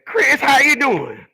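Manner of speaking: put-on high, slow scrunched old lady voice; imitating Mrs Crawley. Imitating Missus Crawley greeting Chris, high scrunched voice. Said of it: "this is the way she talked".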